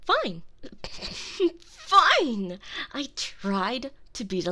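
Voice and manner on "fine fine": bitter resignation. Acted. Turning cold after rejection.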